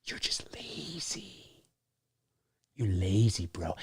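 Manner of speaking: low, drawn-out near-whisper; hushed mock disgust. Host chiding lazy teen trick-or-treaters, hushed disgust. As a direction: let it sink away very quietly.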